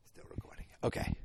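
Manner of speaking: whispered, unvoiced and soft. Real, not acted. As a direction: whisper it so the mic barely hears.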